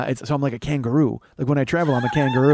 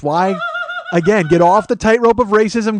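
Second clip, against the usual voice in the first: ordinary talk, nothing marked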